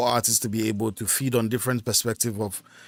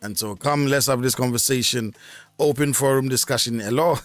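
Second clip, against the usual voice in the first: ordinary talk, nothing marked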